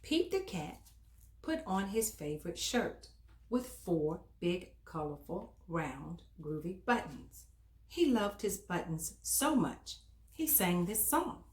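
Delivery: put-on bright, lively read-aloud for little kids; reading to children. A narrator's reading. Storyteller reading aloud to children, animated storytime voice.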